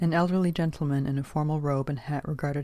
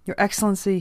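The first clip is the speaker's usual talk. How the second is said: higher, louder appeal; pleading; 6 semitones higher, 6 dB louder than usual. A narrator's reading. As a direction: plead with a superior. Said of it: "he pleaded".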